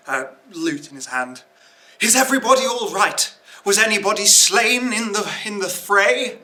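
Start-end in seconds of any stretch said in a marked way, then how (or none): 2.0-6.4 high, loud, quick bard calling out; bard's voice. Acted. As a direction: call out like a worried bard. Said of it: "Cord steps out".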